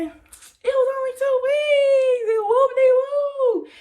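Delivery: high, drawn-out imitation whine; mock complaining. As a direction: wail it slowly in mock protest. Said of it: "you're gonna be like".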